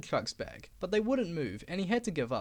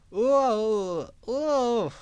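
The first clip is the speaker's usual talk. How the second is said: loud high strained groan; trucks groaning; 4 semitones higher, 8 dB louder than usual. Acted. Old trucks straining under Diesel's push, loud groaning. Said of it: "the trucks groaned".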